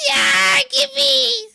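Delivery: high, loud squeal; excited high shout. Real, not acted. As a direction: shriek it out with excitement. Excited outburst.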